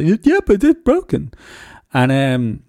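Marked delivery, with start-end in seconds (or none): 0.0-1.3 put-on high, childlike insistence; little son's voice. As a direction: play the small son insisting. Son insists car is broken.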